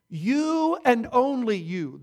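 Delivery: "you" hit hard both times, slowly; stress on you. Shown as a demonstration. Preacher drives home the point to the church, stressing "you". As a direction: lean on each "you" in "You and only you". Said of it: "in the emphatic position".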